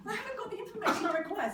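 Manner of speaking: high, loud cry of frustration; hammed-up frustrated outburst; mock frantic exasperation. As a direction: wail it in mock despair.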